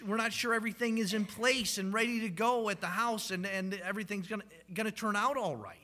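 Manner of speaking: excited preaching. Acted. Preacher recalls first-birth nerves.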